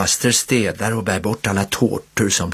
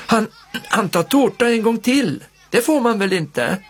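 a hint of a little child, higher, telling on a friend; tattling child; 11 semitones higher than usual. A narrator's reading. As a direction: tattle like a small kid. Anna tattles on Viktor.

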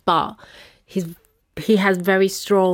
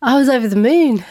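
higher, louder, delighted; answering how she felt, thrilled and louder; over the moon; 7 semitones higher, 5 dB louder than usual. Real, not acted. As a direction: burst out with joy. Joyful answer.

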